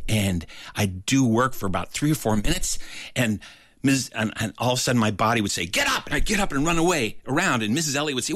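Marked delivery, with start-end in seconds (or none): 5.7-6.1 body's urgent command. Retold or imitated. Body urges him to jump up.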